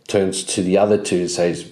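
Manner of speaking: ordinary delivery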